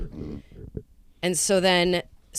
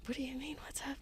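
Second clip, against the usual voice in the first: whisper; 9 semitones higher, 17 dB quieter, faster than usual. Retold or imitated. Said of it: "I was like".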